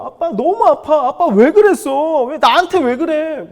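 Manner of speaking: high, loud mock wail; slow, wailing child's reproach; imitated crying child. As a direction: wail it out like a hurt kid blaming dad. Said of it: "막 엉엉 우는 거야".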